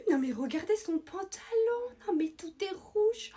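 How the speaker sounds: high, slow, loud exaggerated outrage; theatrical gasping disbelief; mock shock. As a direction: ham up the horror for the whole room. Mocking a girl's stained trousers.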